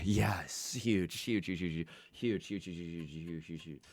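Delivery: whispered, fading to nothing. Real, not acted.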